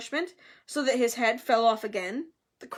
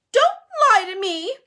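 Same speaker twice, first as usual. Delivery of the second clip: high, loud, wailing accusation; upset gasp; 10 semitones higher, 10 dB louder than usual. A narrator's reading. Myrtle accusing Hermione through tears, wailing. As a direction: wail it in hurt protest. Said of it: "Myrtle gasped".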